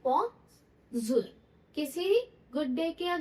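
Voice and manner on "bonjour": "Bonjour" lower, split into bon and zoor; pronunciation demo. Shown as a demonstration. Teacher repeating the pronunciation for students, "Bonjour" as separate bon-zoor. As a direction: model "Bonjour" firmly, each syllable held apart. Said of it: "इसकी pronunciation कैसे है".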